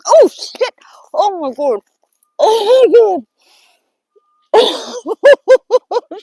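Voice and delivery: high, loud shriek of fright, real; real frightened scream. Real, not acted. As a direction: scream out in real shock at the creature. Finds a scary creature in garden.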